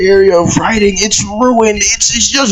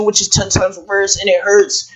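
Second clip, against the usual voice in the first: ordinary talk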